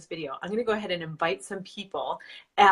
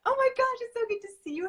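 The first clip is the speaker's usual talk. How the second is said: excited greeting; much faster than usual. Real, not acted.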